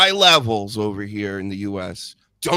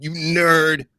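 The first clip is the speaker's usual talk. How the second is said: loud, higher raspy taunt; Pat McAfee raspy; 5 semitones higher, 6 dB louder than usual. Acted. Imitating a commentator fresh from backstage choking, raspy shout. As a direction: bellow it like a choked-up hype man. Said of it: "he comes out sounding all raspy".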